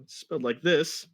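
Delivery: ordinary talk, nothing marked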